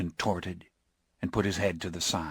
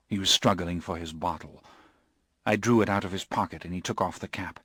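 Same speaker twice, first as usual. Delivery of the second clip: plain reading voice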